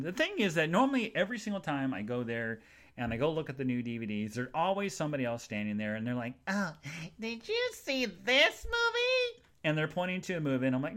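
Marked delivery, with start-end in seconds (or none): 6.5-9.4 very high, slow, put-on squeal; mock nosy stranger. Stranger at the DVD shelf recommending a movie, mock squeal. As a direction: caricature a gushing browser, drawn out.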